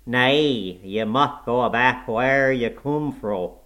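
loud, slow dialect snarl through the nose; snarling nasal scream. A narrator's reading. Old servant sending the visitors back, snarling nasal scream. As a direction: snarl it out loudly, stretching the words. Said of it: "he snarled, or rather screamed through his nose".

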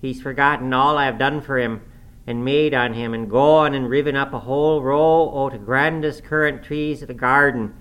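put-on aggrieved old-servant lament, high and loud. A narrator's reading. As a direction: wail the grievance out in dialect. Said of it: "here he lamented outright".

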